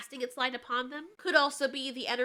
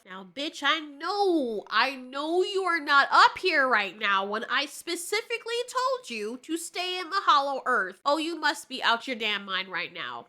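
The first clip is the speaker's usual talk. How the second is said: angry Godzilla; 3 semitones higher, 7 dB louder than usual. Retold or imitated. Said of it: "Godzilla's probably thinking to himself".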